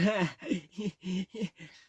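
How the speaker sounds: high loud mocking laugh; Texas guy laughing. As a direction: laugh at your own joke. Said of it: "big old grin on his face".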